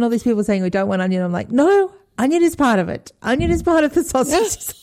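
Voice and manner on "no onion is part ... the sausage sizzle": retold exasperated insistence. Mum telling parents onion is part of it, higher indignant insistence. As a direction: insist on the onions like it's a matter of principle. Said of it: "totally lost it myself".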